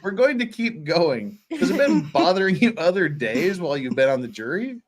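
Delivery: usual speaking voice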